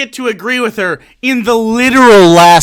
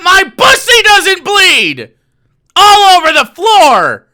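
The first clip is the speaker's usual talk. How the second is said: higher and louder, dragged out slowly; mock outraged shout; 8 semitones higher, 5 dB louder, slower than usual. Mocking Candace about bathroom tampons, a slow hammed-up shout. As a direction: bellow the punchline in fake indignation.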